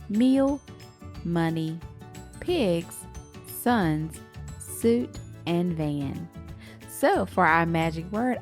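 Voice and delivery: ordinary delivery, nothing marked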